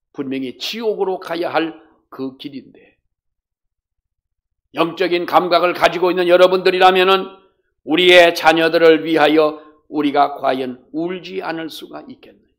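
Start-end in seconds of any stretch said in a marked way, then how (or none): 4.7-12.5 loud, raised sermon voice; loud, emphatic appeal from the pulpit; forceful preaching. Real, not acted. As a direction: urge the congregation with full force.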